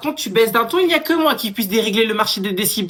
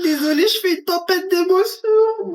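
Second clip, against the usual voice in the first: put-on high, tearful little girl; crying child; 11 semitones higher than usual. Little girl crying to the cashier about her emotions. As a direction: sob out the apology.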